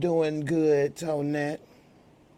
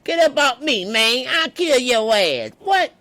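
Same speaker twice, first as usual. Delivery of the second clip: high, loud comic outburst; mock furious shout; 10 semitones higher, 8 dB louder than usual. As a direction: shriek threats in a hammed-up high voice. Acts D-Mac rejecting the singer.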